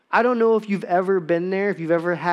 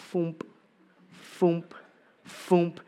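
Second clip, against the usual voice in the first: quiet puffing foomp of a weak machine; soft imitated foomp; 6 dB quieter, slower than usual. Telling the congregation about his snowblower, soft imitated foomp. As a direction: mimic a sputtering snowblower, softly.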